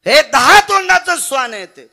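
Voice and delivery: high, explosive outburst; shouted insult. Acted. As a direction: hurl the insult at full voice. Acted insult of the demon king.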